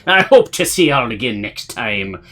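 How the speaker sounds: loud, slow pirate announcer. Acted. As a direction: ham it up like a pirate captain. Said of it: "should do this in the pirate voice".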